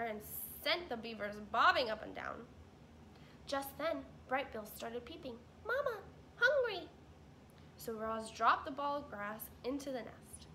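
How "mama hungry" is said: put-on much higher, louder baby bird; gosling calling mama, much higher; hungry gosling. Gosling peeping.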